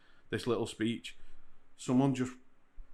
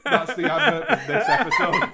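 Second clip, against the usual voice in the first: high, loud, laughing; real laughter; 9 dB louder than usual. Real, not acted. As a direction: joke while cracking up. Host laughing at the joke.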